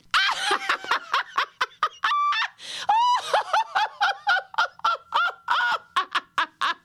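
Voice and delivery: shrieking laugh at full pitch; laugh as loud as you can. Shown as a demonstration. Teacher leading the class to laugh as loud as possible. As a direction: laugh so the neighbours hear. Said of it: "And as loud as you can".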